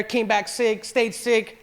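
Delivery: ordinary talk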